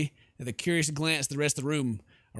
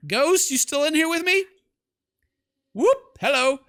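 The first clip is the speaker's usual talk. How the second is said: much higher and louder, jumpy call; 12 semitones higher, 8 dB louder than usual. Real, not acted. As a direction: shout into the empty room half-scared. Calling to the ghost.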